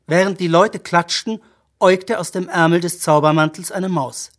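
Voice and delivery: ordinary delivery, nothing marked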